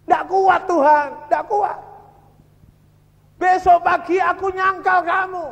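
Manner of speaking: loud, high-pitched cry of despair to God; retold crying out. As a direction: cry out as a prisoner at breaking point. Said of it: "Nangis dia".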